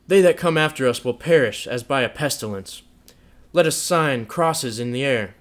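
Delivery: loud, sneering mockery; bitterly mock the holy rites; loud sarcastic scorn; fiendish scorn. A narrator's reading. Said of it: "still with the same fiendish scorn".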